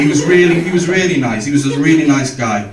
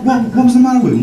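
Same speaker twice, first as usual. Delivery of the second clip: ordinary talk, nothing marked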